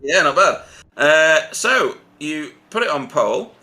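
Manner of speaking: ordinary delivery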